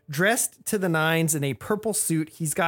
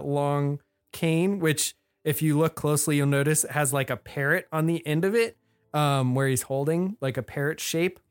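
usual speaking voice

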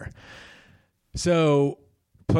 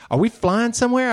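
mock eager question; 10 semitones higher, 3 dB louder than usual. Son's question repeated after interruption.

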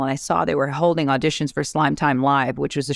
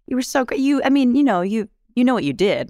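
lightly voiced mom, higher and gushing; a hint of a sweet, soothing mother; mom consoling; 8 semitones higher than usual. Daughter imitating mom praising her after audition, higher and sweet. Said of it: "my mom who was like".

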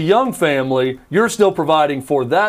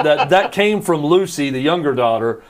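usual speaking voice

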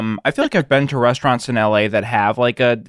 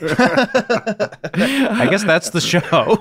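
high, slow, laughing through it; 9 semitones higher, much slower than usual. Real, not acted. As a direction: giggle out the line in disbelief.

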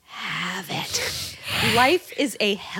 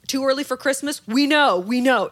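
louder, higher knowing tone; passive-aggressive sign; 4 semitones higher, 6 dB louder than usual. Retold or imitated. Reading the mall sign in a passive-aggressive voice. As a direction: read the sign smugly. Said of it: "passive-aggressive".

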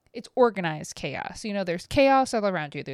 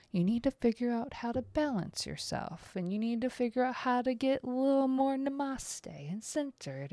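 soft guru voice; 4 semitones higher, 8 dB quieter than usual. Retold or imitated. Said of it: "I'm getting this of".

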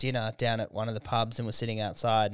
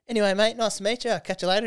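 friendly local; 9 semitones higher, 5 dB louder, faster than usual. Retold or imitated. Imitating the friendly stranger leaving.

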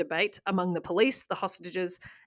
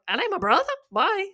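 much higher, quick hammed-up panic; mock fleeing boy; 11 semitones higher than usual. Teenage brother fleeing the gunman, high mock panic. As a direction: squeak it out and bolt.

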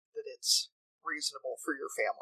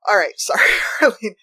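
louder, laughing through sorry; laughing apology; 5 semitones higher, 10 dB louder than usual. Real, not acted. Host apologizing to Arlene for the pot question, laughing. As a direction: giggle out the apology to her.

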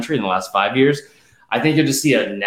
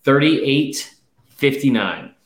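slow dictation; much slower than usual. Real, not acted. Reading number for note-taking.

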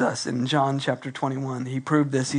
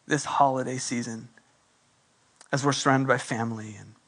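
closing prayer; 2 semitones lower than usual. Acted.

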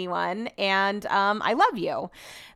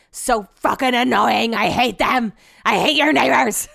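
hammed-up, slow, loud frustration; mock-hateful venting; 4 dB louder than usual. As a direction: growl it out in exaggerated annoyance. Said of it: "I was venting to her".

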